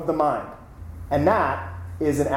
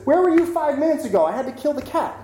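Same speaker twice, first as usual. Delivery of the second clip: high-pitched, fast outburst; acted exasperation; 10 semitones higher, faster than usual. Zen master to the kitchen kid who came too late, acted exasperation. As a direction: exclaim it in mock dismay.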